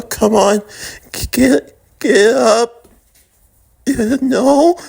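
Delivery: high, breaking plea to a fallen friend; desperate pleading. Acted. Nobara over Frank's body, pleading through tears. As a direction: beg him to get up, voice cracking.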